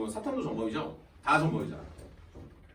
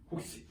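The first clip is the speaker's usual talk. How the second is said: mock gasp; 11 dB quieter, slower than usual. Acting a student spotting an older classmate.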